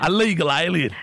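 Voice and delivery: high, hammed-up English accent; poor English accent. Prank caller quotes the song to cafe, poor English accent. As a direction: play up the fake Englishman. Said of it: "disregard the piss poor English accent".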